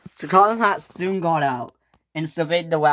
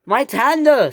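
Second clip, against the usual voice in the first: high, loud wail of dismay; loud, high outcry; screamed protest; 9 semitones higher, 6 dB louder than usual. Acted. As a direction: cry out over your lost property. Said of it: "screamed James".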